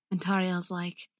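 normal talking voice

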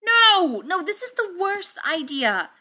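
loud, high-pitched yell; alarmed protest. Acted. Tony protesting the wrestling, alarmed shout. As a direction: cry out to stop it, voice way up.

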